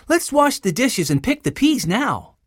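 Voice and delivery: put-on high, lively young voice; organizing girl Jessie. A narrator's reading. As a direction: suggest it like a keen elder sister. Jessie directing the children.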